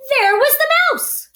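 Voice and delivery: high, loud gasp of discovery; spring the surprise on the kids; surprised reveal. A narrator's reading. Reading to children, big surprised reveal.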